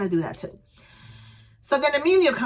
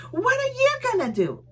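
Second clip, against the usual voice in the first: confrontational. Retold or imitated. Drunk girl confronting her.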